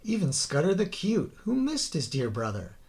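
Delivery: ordinary delivery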